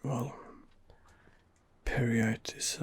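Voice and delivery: ordinary talk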